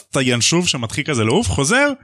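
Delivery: normal talking voice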